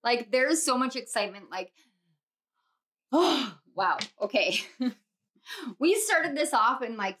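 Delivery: ordinary delivery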